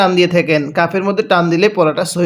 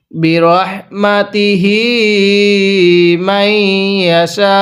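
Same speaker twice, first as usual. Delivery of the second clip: slow, stretched recitation with a long madd. Shown as a demonstration. Teacher showing the long pull. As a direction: draw out the vowel. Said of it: "হ্যাঁ, কিভাবে শিখব?".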